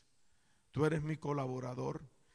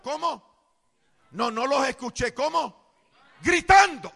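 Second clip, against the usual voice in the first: loud high shouts pushing the crowd; preacher shouting at congregation to reply; calling out to the crowd; 7 semitones higher, 12 dB louder than usual. Acted. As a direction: demand an answer, louder each time. Preacher prodding congregation to respond.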